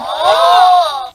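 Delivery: high delighted gasp; sudden thrilled intake; excited gasp. Acted.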